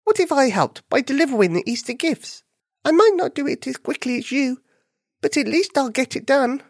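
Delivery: high, eager little bunny. Acted. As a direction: offer help brightly and high. Cartoon bunny offers help.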